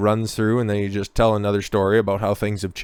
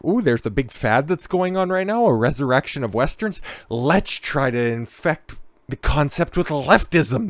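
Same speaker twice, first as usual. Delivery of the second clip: higher, put-on eager voice of a trend-chaser; mock bandwagon jumper; 5 semitones higher than usual. Host mimics latchers-on spotting a big fad, mock-excited. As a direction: mimic someone latching onto a fad, pitch jumping up at the start. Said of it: "one of these latchers-ons".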